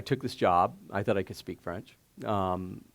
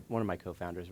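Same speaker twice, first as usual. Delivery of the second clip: ordinary talk, nothing marked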